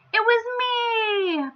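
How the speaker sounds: high, slow proud squeak; piping up. Acted. Mister Silly proudly confesses to the king in a high pipe. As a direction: chirp it up proudly. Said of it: "piped up Mister Silly".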